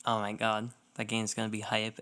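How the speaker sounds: ordinary talk, nothing marked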